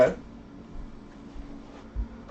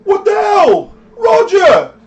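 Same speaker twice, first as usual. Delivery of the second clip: high, loud, frantic shouting; panicked thug leader; 11 dB louder than usual. Acted. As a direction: yell to stop the fight in a panic. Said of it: "the leader guy".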